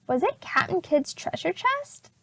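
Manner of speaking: ordinary delivery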